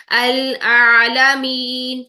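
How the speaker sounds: العالمین said slowly, the عین pressed back in the throat; recited with عین. Shown as a demonstration. Teacher reciting العالمین for students, slow throaty عین. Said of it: "زبان کو پیچھے کی طرف پریس کریں گی".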